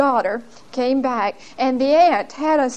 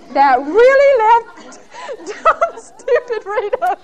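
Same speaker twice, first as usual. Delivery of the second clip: high, slow, laughing at herself; mock yourself through laughter; 12 semitones higher, 6 dB louder, slower than usual. Real, not acted.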